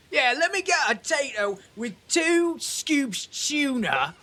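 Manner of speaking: high, hammy mock-British bloke; hammed-up Cockney. Streamer answers a bad accent rating with a hammy Cockney food order, high and slow. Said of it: "Two out of ten accent".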